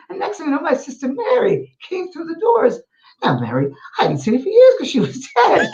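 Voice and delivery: high-pitched Scottish woman; Scottish accent. Retold or imitated. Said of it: "And it was a Scottish woman".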